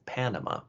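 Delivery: Panama said American style, three different vowels; "PAN-uh-muh" with a flat first A; American English. Shown as a demonstration. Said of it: "in American English we're actually making three different sounds".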